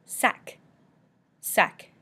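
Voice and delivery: French sac ending in a k; final C sounded. Shown as a demonstration. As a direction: say sac with the C pronounced. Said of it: "Pronounce the C at the end".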